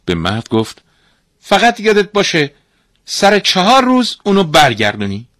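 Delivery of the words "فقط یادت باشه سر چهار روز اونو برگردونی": high, loud insistent reminder; warning master. Acted. Master giving the father a deadline, insistent. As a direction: lay down the condition forcefully, high-pitched.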